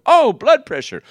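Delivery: high, loud, bright mock surprise on "Oh"; excited exclamation. Telling a proud patient about her blood pressure, playfully excited. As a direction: burst out with playful glee. Acting out her own cheeky reply.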